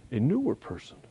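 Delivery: ordinary talk, nothing marked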